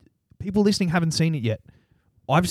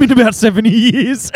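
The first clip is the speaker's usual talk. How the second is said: laughing; 8 semitones higher, 8 dB louder than usual. Retold or imitated. Laughing at own tattoo joke.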